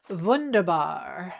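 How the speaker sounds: "wunderbar" said slow and drawn out, German style; German word. Real, not acted. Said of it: "I don't know why I said that like that".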